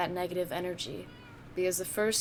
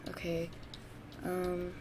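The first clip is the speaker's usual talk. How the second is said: ordinary talk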